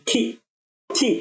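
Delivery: খি repeated as a clean isolated syllable; demo sound. Shown as a demonstration. Teacher repeating খি for students, carefully enunciated. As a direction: drill খি clearly. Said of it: "দুটোই খি sound".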